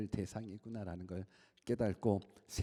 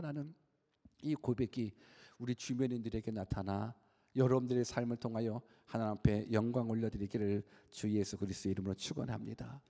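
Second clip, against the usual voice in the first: ordinary talk, nothing marked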